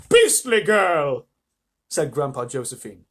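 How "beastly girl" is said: slow, loud, high condemnation; outraged old relative, pitched up; disgusted grandparent. Acted. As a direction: draw it out in loud disapproval.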